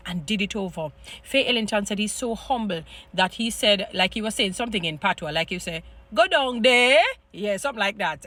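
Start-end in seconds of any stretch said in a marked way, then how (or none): 6.1-7.0 "Go down there" lilted up high and loud, sing-song; sing-song off-Jamaican. Retold or imitated. Actor's first try at the patois line, sing-song and off. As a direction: sing "Go down there" like another Caribbean island. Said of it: "he kinda sing up the day".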